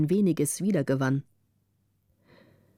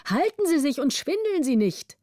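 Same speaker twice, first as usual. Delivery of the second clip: higher urgent warning; calling out; 7 semitones higher than usual. A narrator's reading. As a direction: call up the warning anxiously. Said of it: "riefen die hinter ihm". (Kollegen warnen den wankenden Ratsherrn, höher gerufen)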